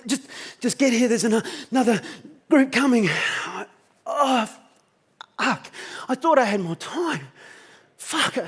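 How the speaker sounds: high, choked, near-crying voice; breathless distress. Retold or imitated. Dying mate begging Troy to get there, breathless distress. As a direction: struggle for air between broken phrases. Gasping friend breaking down on phone.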